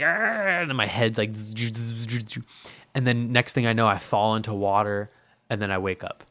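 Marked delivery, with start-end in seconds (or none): 0.0-0.7 high mock yell of pain. Dreaming a bug is inside his brain, pained yelp. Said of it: "I'm like". 1.3-2.4 soft bug buzzing. Retold or imitated. A bug in his brain, soft imitated buzzing.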